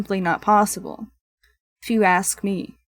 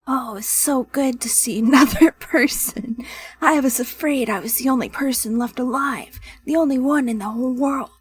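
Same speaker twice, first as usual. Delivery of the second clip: raspy, higher character voice; raspy girl; 3 semitones higher than usual. Acted. Village girl greets the first person she's seen, raspy and higher. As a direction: rasp it out as an effusive, relieved survivor. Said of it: "just make it kinda raspy".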